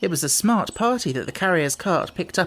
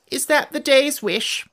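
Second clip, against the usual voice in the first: yawning fairy voice, higher; 11 semitones higher, 3 dB louder than usual. A narrator's reading. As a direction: yawn through the question.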